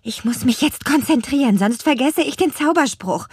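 put-on high, eager girl witch; young witch voice. A narrator's reading.

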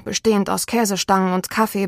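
ordinary delivery, nothing marked

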